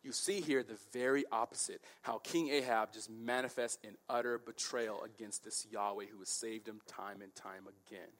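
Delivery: usual speaking voice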